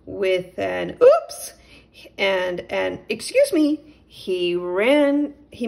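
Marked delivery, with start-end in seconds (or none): 1.0-1.5 very high, loud yelp of surprise; little mole caught off guard; startled oops. Acted. 3.1-4.0 high, loud hasty apology; nervous little mole excusing himself; flustered mole. Acted.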